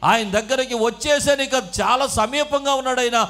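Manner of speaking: loud, high-pitched impassioned sermon delivery; full-force preaching. Real, not acted.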